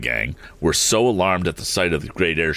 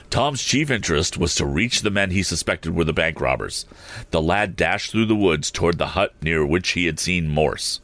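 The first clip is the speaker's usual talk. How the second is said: ordinary delivery, nothing marked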